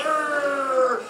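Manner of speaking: mock effortful grunt of flexing; hammed-up flexing grunt; straining grunt. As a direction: grunt like lifting something heavy.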